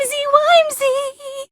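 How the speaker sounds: high, slow, soft cutesy sign-off. Acted. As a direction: sweeten it like a cute mascot. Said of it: "you made me go all cutesy".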